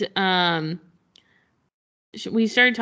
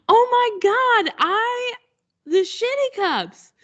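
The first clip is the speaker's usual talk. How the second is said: much higher, louder squeal of recognition; squealing fan; 4 dB louder, slower than usual. Retold or imitated. Fan spots the Shitty Cups, squealing with delight. Fan realizes cup idea.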